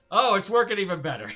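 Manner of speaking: high, loud, fast hammed-up delight; mock gleeful heroine. Mocking Rotha enjoying the pain, hammed-up glee. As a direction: gush over the pain for laughs. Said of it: "And she's".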